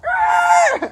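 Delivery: high, loud hammed-up scream; a retold panicked scream, played for laughs; mock scream. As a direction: shriek it like a scared driver. Friend guns it, screaming.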